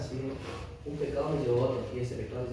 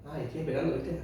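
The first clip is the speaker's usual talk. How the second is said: ordinary delivery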